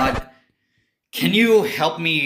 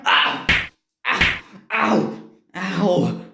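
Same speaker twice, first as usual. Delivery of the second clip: short yelps of pain, one after another; a string of hurt yelps; pained ow cries; 5 semitones higher, much slower than usual. Acted. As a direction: cry out ow, ow, ow.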